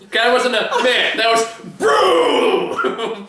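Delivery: loud, high, drawn-out shout; excited shout. Acted. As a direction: shout it out, hyped up. Acting out the zombie hit.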